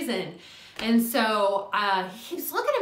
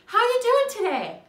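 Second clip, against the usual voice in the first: cashier imitation; 13 semitones higher, 6 dB louder than usual. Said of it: "the girl asked me".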